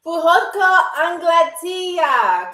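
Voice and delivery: slow clear demo; slowly. Shown as a demonstration. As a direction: say Puhotko Anglatia slowly for learners. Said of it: "listen to it slowly".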